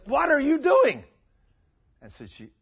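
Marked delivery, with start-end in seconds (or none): 0.1-1.0 high, loud outcry of a startled crowd; acted scandalized shout; shocked shout. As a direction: yell it in disbelief. Shocked congregation voiced in story.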